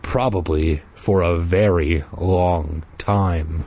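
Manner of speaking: low, slow and icy. Acted. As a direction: chill him with cold calm. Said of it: "He replied in a low, icy voice".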